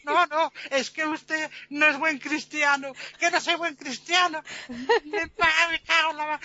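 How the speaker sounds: high, exasperated mock outburst; desperate indignation. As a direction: splutter at the insult and break off mid-curse. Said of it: "el hombre ya desesperado".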